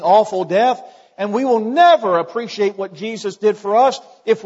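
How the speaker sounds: loud, high, driving sermon voice; fervent pulpit delivery; full-force preaching. Acted. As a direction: preach it out to the whole hall.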